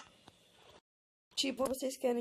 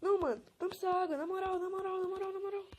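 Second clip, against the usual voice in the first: panicking gamer; 7 semitones higher, faster than usual. Real, not acted. Gamer panicking over the water.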